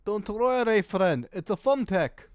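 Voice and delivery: put-on a vague Eastern European merchant accent, lightly done; sly salesman voice with an unplaced European accent; mystery European accent. Shady merchant reassuring a customer, in a put-on European accent. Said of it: "don't ask me what this accent is".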